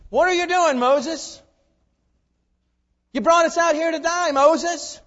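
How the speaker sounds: loud, high accusing yell at Moses; angry crowd. Acted. Israelites at the Red Sea accuse Moses: loud, angry yell. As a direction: hurl the blame at him in panic.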